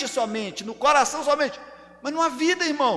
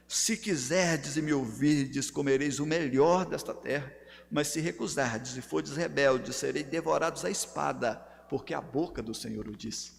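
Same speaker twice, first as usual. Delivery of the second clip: much lower, softer voice; solemn scripture reading; 10 semitones lower, 7 dB quieter than usual. Real, not acted. Preacher closing a Bible passage for the congregation, solemn scripture reading. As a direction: read the verse low and hushed.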